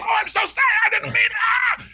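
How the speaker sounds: high mock wail into a cry; hammed-up begging for mercy; desperate apology. Pinned brother begs, cries out.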